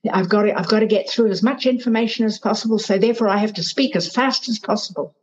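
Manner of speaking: fast, tumbling inner panic; anxious rushed thoughts. Retold or imitated. Coach imitating a rushed exam candidate's thoughts, fast and anxious. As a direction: rattle it off like you're out of time. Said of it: "you think".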